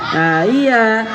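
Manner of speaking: ordinary talk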